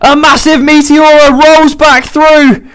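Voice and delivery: sudden burst of excitement; excited commentator. Real, not acted. Sudden comeback moves.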